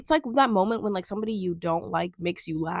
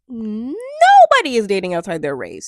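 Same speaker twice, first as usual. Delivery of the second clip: nobody pushed out loud and long; exasperated loud emphasis; emphatic louder complaint; 9 dB louder than usual. Real, not acted. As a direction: hammer home the point, louder.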